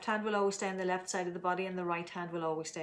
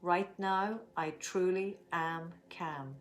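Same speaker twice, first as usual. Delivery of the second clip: slow calm affirmation; much slower than usual. Shown as a demonstration. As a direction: say it slowly, letting calm settle. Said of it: "let's make our statement".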